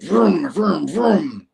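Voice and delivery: loud, high vocal engine rumbles; imitated vroom. Storyteller imitating the noise heard underground, loud repeated vrooms. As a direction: rev out three mouth-made vrooms. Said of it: "And then you hear this".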